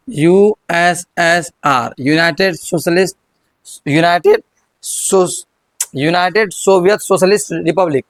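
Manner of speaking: each letter drawn out slowly for the class; slow dictation. Shown as a demonstration. Teacher spelling the acronym for students, very slow.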